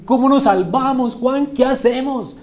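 súplica angustiada en tono mucho más agudo; actúa a la multitud asustada que pide salida; gente temblando. Acted. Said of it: "esta gente que está temblando así". (pregunta alarmada, teatralizada)

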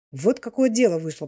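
ordinary delivery

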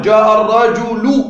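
الرجل slowly, with a long sounded -u, ar-rajulu; pronounced damma. Shown as a demonstration. Teacher comparing endings for students, الرجل stretched to ar-rajulu. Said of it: "ننطق ضم على اللام".

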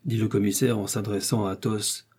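ordinary delivery, nothing marked

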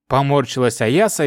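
ordinary delivery

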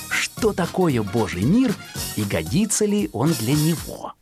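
usual speaking voice

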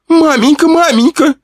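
very high, rising tearful wail; wailing lament. Acted. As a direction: wail for mother over and over. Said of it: "запричитала Лиза".

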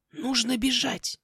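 high, slow, drawn-out croak; hushed raven warning. Acted. Raven warning the girl after the visit, hushed urgency. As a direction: urge flight slowly, without shouting. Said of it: "тихо проговорил ворон".

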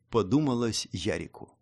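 usual speaking voice

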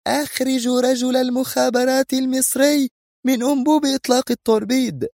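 very high, piercing stern order; stern commanding leader. A narrator's reading. Female leader orders the Egyptian agent removed, stern and commanding. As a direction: order them sharply in a high-pitched voice. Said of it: "بلهجة صارمة آمرة".

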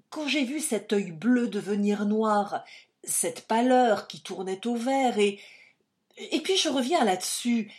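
loud, high, foolish fearful pleading; foolish and frightened. A narrator's reading. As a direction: babble the excuse in a panicky rush of nerves. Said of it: "de son air le plus bête".

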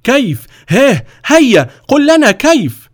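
loud, high-pitched angry challenge; exasperated. A narrator's reading. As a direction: cut in furiously, demanding an answer. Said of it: "قال الوزير في غيظ".

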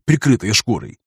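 plain reading voice

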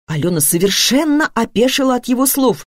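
ordinary delivery

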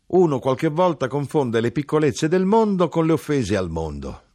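high, loud character voice; knife-grinder, elated. Acted.

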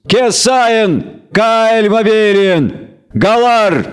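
a newcomer commanding the elves to stop; resonant call. A narrator's reading. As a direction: call out from afar to halt them. Commanding voice continues.

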